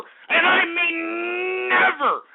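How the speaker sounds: high, slow cracking shout. Real, not acted. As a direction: yell "never" slowly. Emphatic vow against ponies.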